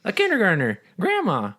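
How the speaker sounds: high, evenly spaced falling names; mock roll call. Naming covid dead for days, mock roll call. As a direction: call each name high, letting it drop.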